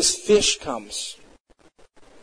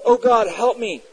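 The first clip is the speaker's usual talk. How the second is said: higher-pitched, mildly raised plea; retold cry for help; 6 semitones higher, 4 dB louder than usual. Unbelievers calling on God in times of trouble, mild cry out. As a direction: call out to God, without a full shout. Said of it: "they will cry out".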